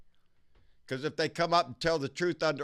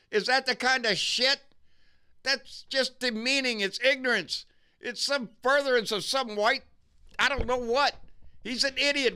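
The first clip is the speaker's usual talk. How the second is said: higher, louder, incredulous ranting; real indignant rant, pitched up and pushed loud; outraged disbelief; 10 semitones higher, 4 dB louder than usual. Real, not acted. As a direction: let the outrage boil over. Outraged rant about the senator's list.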